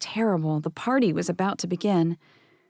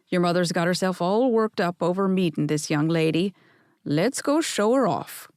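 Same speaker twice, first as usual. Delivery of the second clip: lightly done Scottish grandfather, kindly; a hint of a Scottish burr, reassuring then hearty; mild Scottish old man at home; soft Scottish brogue. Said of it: "in his soft brogue".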